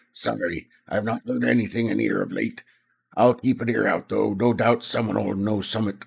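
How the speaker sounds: gravelly pub landlady. Acted. Landlady telling Tony she has heard nothing, gravelly voice. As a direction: put some gravel in the voice. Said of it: "I shouldn't have done a gravelly voice".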